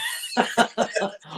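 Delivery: breathy high laugh; high giggle. Real, not acted. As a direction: laugh at your own jab. Laughing at own joke.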